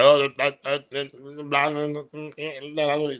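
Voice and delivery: panicked stammer, words tumbling out; stuttering. Retold or imitated. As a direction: imitate a flustered caller stuttering. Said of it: "Like, he's stuttering".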